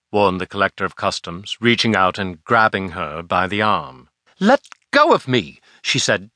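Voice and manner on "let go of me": higher, sharp protest; sharp retort. Acted. Girl shaking off the collector's grip, sharp and higher. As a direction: snap it back at him, mildly raven-sharp. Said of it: "sharp cackle of a raven".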